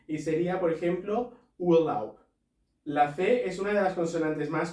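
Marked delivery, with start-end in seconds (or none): 1.6-2.2 "Urlaub" with final b said as p, louder; German word demo. Shown as a demonstration. Teacher modelling final B as P in German. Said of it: "como una P de Pamplona".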